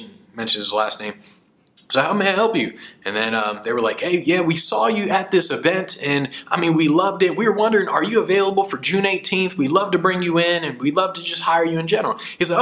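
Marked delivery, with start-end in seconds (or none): 2.0-2.8 polished phone greeting. Retold or imitated. Friend answering a booking call. 4.0-12.2 eager client caller. Retold or imitated. Event organizers voiced on speakerphone.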